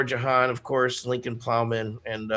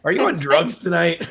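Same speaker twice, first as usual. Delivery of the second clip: laughing; 5 semitones higher, 6 dB louder than usual. Real, not acted. Teasing co-host, laughing.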